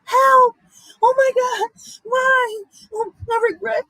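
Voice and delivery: high, loud mock cries for help; mock screaming. Vlogger mocking people when karma knocks, screaming in pretend panic. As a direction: scream like a panicked person begging, hammed-up. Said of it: "y'all motherfuckers out here screaming".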